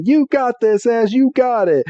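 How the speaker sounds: high-pitched sideline cheer; mock cheering. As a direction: egg a friend on in a high voice. Pokemon cheer Ash on from background.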